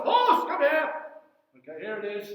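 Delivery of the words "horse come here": loud call to a horse; shout. Shown as a demonstration. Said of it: "shout your horse".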